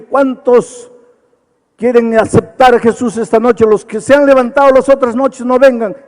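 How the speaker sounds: high, loud summons to the congregation; loud altar call from the pulpit; loud preaching call. Retold or imitated. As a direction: call out across the church. Preacher retells his altar call.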